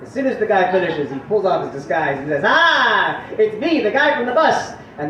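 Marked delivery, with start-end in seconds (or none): 2.4-4.7 raised, crowing unmasking; triumphant reveal. Acted. Guy pulling off his disguise, triumphant raised voice. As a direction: announce the big reveal, higher and louder.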